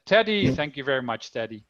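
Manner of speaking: ordinary talk, nothing marked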